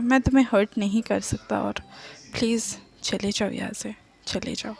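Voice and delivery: low, very soft, fading away; quietly. A narrator's reading. Wang Yibo quietly sending the crying lover away, very soft and low. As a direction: tell him to go almost under the breath. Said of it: "चुपचाप कहता है".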